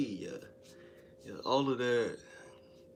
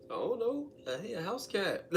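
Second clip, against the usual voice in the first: much higher, lightly innocent tone; mock innocent reply; 12 semitones higher than usual. Teller answering his date about the cat, mock-sweet and high. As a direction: answer sweetly as if it's nothing odd.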